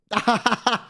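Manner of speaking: high staccato cackle; amused cackle at own joke; laughing. Real, not acted. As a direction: laugh in quick ha-ha bursts.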